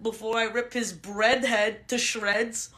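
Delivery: normal talking voice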